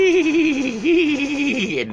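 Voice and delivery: high loud horse neigh; whinny like a horse. Shown as a demonstration. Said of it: "A horse sounds like".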